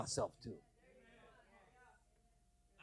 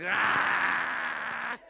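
anguished yell; 5 semitones higher than usual. Retold or imitated. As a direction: throw yourself down and cry out. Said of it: "I threw myself on the floor".

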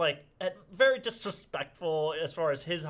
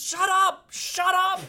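imitating a father's high, loud yell; exasperated dad yelling; 8 dB louder, slower than usual. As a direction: shout over the hysterics to cut them off. Exasperated dad silences screaming son.

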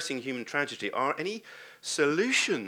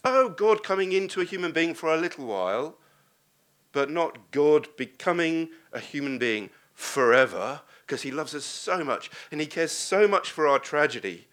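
louder, driving to the climax; fervent preaching; 3 semitones higher, 6 dB louder than usual. Acted. Preacher proclaiming God's love to the church, loud and fervent. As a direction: preach it home with conviction.

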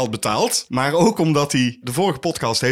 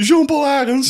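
hyped announcement; 11 semitones higher, 4 dB louder than usual. Acted. Announcing new members.

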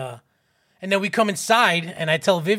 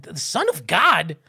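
slow, incredulous repeat as a question; imitated amazed echo; 3 dB louder, slower than usual. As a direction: echo it back in drawn-out disbelief. Children echo in disbelief.

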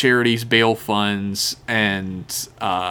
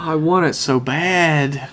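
slow, drawn-out, higher-pitched longing; exaggerated yearning; 4 semitones higher, slower than usual. Real, not acted. Co-host craving the bomb prop, exaggerated yearning. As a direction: stretch the words with over-the-top wanting.

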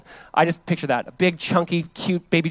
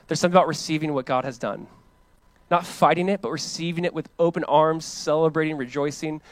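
ordinary talk

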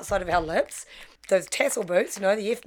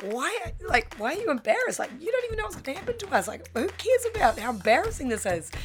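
much higher, rushing frantic disbelief; exasperated; 9 semitones higher than usual. Retold or imitated. Girl snapping back at her embarrassed friend, high and frantic.

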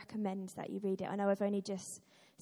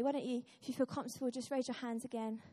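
ordinary delivery

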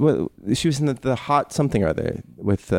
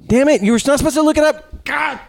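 mock outrage. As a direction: pretend to be furious they looked it up.

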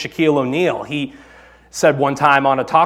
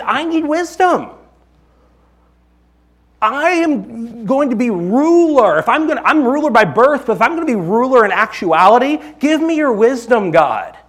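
Solomon's plea, pitched much higher and earnest; Solomon pleading; 9 semitones higher than usual. Retold or imitated. Pastor voicing Solomon praying to God, high and earnest. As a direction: cry up to God like a young king asking for wisdom.

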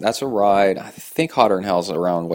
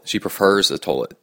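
ordinary delivery

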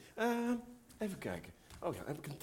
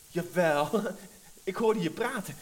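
insisting; 8 dB louder, slower than usual. Acted. Girl insists in quarrel.

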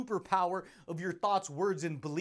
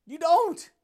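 sudden loud emphasis; 9 semitones higher, 10 dB louder than usual. Real, not acted. To the listener stuck in the past, a loud high retort. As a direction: shout the denial high and loud. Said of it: "emphatic retort, big jump".

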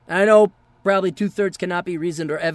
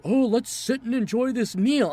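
higher, mock-leisurely voice; mocking imitation; 4 semitones higher than usual. Mimicking people who linger over a meal, mocking.

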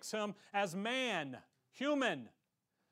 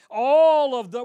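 preaching burst; 5 semitones higher, 17 dB louder than usual. Acted. Preacher voicing Christ's testimony.

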